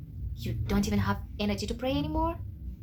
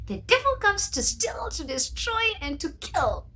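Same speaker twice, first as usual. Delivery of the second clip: higher, declaiming sermon line; preaching; 10 semitones higher than usual. Acted. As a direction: preach it like a pastor. Preaching scripture.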